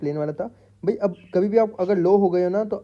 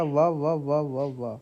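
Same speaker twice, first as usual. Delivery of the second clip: ordinary talk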